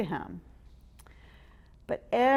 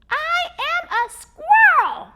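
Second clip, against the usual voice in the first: high, slow, much louder squeaky yell; squeaky squirrel shout; 12 dB louder than usual. Acted. Squirrel yelling at the boy, squeaky shout. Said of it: "he shouted again in his squeaky voice".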